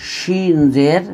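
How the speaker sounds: ordinary talk, nothing marked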